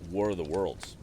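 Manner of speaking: usual speaking voice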